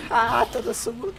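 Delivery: high, loud satisfied laughing; gleeful laugh. Real, not acted. Gamer gloating after a good play, gleeful laugh. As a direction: relish your win.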